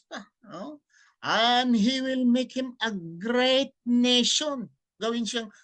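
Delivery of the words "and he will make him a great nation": slow, loud, solemn Bible reading; scripture reading. Acted. Preacher reading the Bible verse aloud to listeners, solemn declaiming. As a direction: proclaim each verse slowly to the audience.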